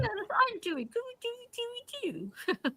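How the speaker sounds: put-on high-pitched baby talk to pets. Shown as a demonstration. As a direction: coo little nonsense syllables way up high. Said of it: "baby talking to my animals".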